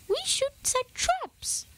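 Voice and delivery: high squeaky little mouse; slow, high mouse suggesting traps. A narrator's reading. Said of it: "one mouse suggested".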